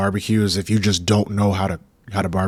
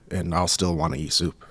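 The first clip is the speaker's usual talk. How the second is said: normal talking voice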